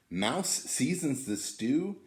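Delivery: ordinary delivery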